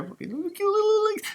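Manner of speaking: high cooing over the cute legs; high, soft coo. Acted. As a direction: coo at the little toy. Cooing over toy penguin.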